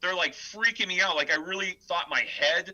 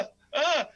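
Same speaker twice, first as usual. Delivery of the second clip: high strained stoned groaning; overwhelmed stoner grunt; dazed groan; 11 semitones higher than usual. Retold or imitated. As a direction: groan like your head might burst.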